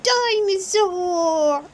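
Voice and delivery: put-on high, slow, loud toddler shout of dinosaur; a tiny boy yelling his favourite word; drawn-out baby shout. A narrator's reading. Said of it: "he shouts".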